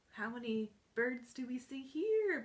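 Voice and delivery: ordinary delivery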